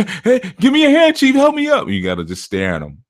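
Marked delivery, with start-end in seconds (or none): 0.0-1.8 very high, loud cry for help; mock panic. Person slipping off a cliff calling for help. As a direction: call up from the cliff edge.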